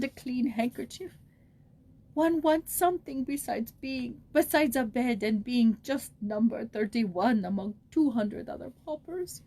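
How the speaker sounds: put-on old pauper pouring out her loneliness to a child; tearful old woman. A narrator's reading. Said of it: "still broken by sobs".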